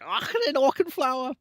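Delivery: high, loud exaggerated German accent. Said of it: "really racist German accent".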